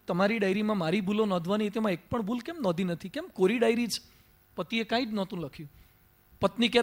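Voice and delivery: quiet, intimate parable told to the congregation; soft storytelling. Acted. Monk softly tells the assembly a married couple's diary story. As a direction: draw them in with a soft, hushed story voice.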